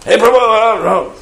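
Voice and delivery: very high, forceful holler; forceful village call. Acted. Village ladies of Vrindavan calling, mock high forceful yell. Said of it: "they're so jorse".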